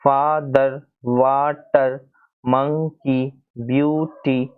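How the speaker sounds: father, water, monkey, beauty, each split into two beats; two-syllable demonstration. Shown as a demonstration. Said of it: "दो part में उसको pronounce करते हो".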